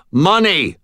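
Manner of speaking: loud barked demand; shouted. A narrator's reading. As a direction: shout for the money. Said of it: "I shouted".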